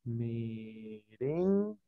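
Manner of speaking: very slow, soft "Merengue", syllables drawn out; dictating. Shown as a demonstration. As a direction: sound out "Merengue" quietly as you write it. Sounds word out while writing it.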